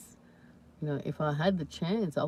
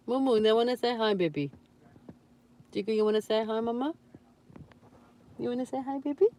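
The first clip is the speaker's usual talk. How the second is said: higher, lilting talk to a pet; pet-coaxing voice; 6 semitones higher, faster than usual. Real, not acted. Owner urging pet to greet viewers, high coaxing lilt.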